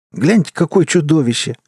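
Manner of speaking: put-on boy shouting. A narrator's reading. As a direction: call out to the gang, jeering. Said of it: "закричал один из мальчишек".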